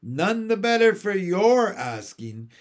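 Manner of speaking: sharp, dismissive put-down; Mary Poppins snubbing the starling, curt; curt retort. A narrator's reading. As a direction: brush him off tartly. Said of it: "tossing her head".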